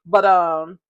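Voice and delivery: usual speaking voice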